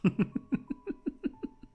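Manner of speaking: high, squealing laugh in short bursts; high-pitched laughing fit. Real, not acted. Streamer laughing at the dropping viewer count, high giggling fit. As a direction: crack up helplessly, very high.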